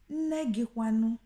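asking a guest about their mother; emphatic ask. Shown as a demonstration. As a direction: ask Nne gị kwanu with emphasis on nne. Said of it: "for short and for emphasis".

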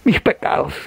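imitating sobbed confession, dropping to a whisper midway; mock tearful penitent. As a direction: play a weeping penitent, voice falling away. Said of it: "¿Por qué llora?".

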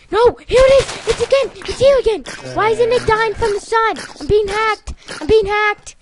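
loud high panicked yelling at the game; panicked shouting. Real, not acted. Gamer yelling at an unkillable zombie, loud and panicked. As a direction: shriek in real alarm as the zombie keeps coming.

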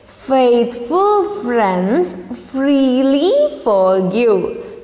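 drawn out word by word; very slow reading. Shown as a demonstration. Teacher repeating the twister for students, very slow. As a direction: stretch each word very slowly. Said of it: "Once again".

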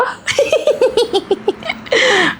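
high, drawn-out giggle; giddy giggle. Acted. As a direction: giggle over a crush. Swoons over an actor's abs. (kinikilig na hagikgik)

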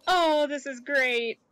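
normal talking voice

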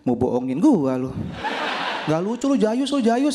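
high, laughing mock scoff; mock scoffing friend. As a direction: brush him off as a lame joker. (teman mengejek dengan suara tinggi dibuat-buat)

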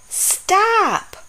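high sudden shout of stop; sharp stop. Acted. Dinosaur stops another from scratching.

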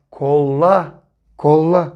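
"Kolla" with the l held long, kol-la; drawn-out double l. Shown as a demonstration. Teacher holds the double l in "Kolla" for children.

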